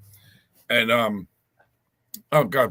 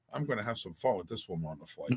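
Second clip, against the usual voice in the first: much softer, scheming aside to his wife; mischievous aside; 5 semitones lower, 14 dB quieter than usual. Retold or imitated. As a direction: hush it to Lana like a secret. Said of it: "I went".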